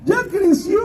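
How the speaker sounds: very high, gleeful-sounding godmother caricature. Retold or imitated. Godmother announcing the character's growth, in a very high put-on voice. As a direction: blurt the news in a high put-on voice. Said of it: "¿Qué creo, madrina?".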